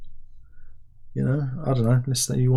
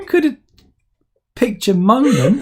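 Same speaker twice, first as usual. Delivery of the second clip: mock reproach; 11 semitones higher, 7 dB louder than usual. Real, not acted. Teasing co-host for interrupting.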